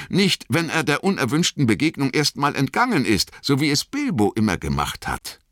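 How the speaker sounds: high-pitched hobbit protest; Merry. A narrator's reading. Merry argues that Frodo would remove the Ring, high-pitched.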